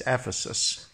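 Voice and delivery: ordinary talk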